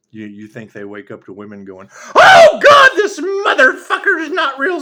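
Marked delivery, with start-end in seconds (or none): 2.1-4.8 very high, loud laughing shriek; hammed-up shocked woman. Hammed-up women waking up next to him, shrieking. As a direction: shriek it in mock awe.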